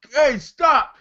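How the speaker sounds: high-pitched call to halt; retold warning shout. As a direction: shout it like ordering a dog off. Acts out yelling at attacking dog.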